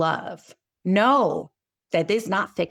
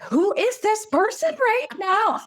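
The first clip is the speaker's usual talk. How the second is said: high, slow, strained outburst in a strange voice; mock fury, voice way up; losing it; 12 semitones higher than usual. A mother reliving her coffee meltdown, high strained outburst. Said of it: "I was like".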